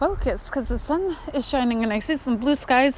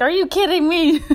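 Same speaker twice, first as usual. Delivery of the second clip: higher, louder mock outrage; mock indignant; 7 semitones higher, 6 dB louder than usual. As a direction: mom protesting she got nothing. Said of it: "She's like".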